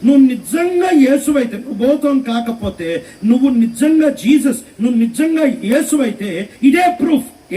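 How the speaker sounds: high-pitched challenge; doubting Peter. Acted. Doubting disciple to the figure on the water, demanding proof. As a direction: pitch it high, pressing hard on నిజంగా. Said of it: "ప్రూఫ్లో ఏమడిగాడు తెలుసా?".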